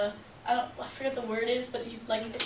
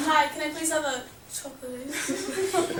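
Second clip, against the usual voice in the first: imitating a friend's prank voice; prank order; 3 semitones higher than usual. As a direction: repeat the silly order in a mock-polite voice. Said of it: "please, like, speak up or leave".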